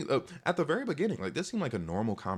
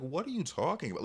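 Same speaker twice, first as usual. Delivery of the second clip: higher-pitched retold bafflement; mildly frustrated; 7 semitones higher than usual. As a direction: push back, a touch exasperated. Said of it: "it was, like, almost frustrating".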